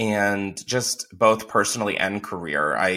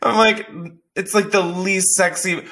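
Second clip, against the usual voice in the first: laughing; 8 semitones higher than usual. Retold or imitated. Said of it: "And I was like".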